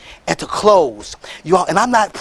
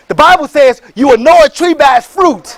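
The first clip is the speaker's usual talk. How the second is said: loud, higher, rising preaching; preaching shout; 6 semitones higher, 6 dB louder than usual. Real, not acted. Quoting scripture to the crowd, a loud preaching shout. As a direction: preach it with full force.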